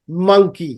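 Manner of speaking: M held at the start of "monkey"; nasal M in "monkey". Shown as a demonstration. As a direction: hum into the M of "monkey". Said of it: "সাউন্ডটা নাক দিয়ে বেরোচ্ছে".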